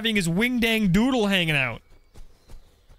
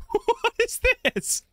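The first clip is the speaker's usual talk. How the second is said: much higher, slow, through a laugh; high giggly mock question; squeaky laughing repeat; much slower than usual. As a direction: squeak it out in amused disbelief.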